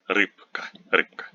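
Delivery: рыбка said "RIP-ka"; voiceless p before the k in рыбка; devoiced consonant. Shown as a demonstration. Teacher illustrating devoicing, рыбка with a p sound. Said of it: "before a voiceless consonant like K become voiceless".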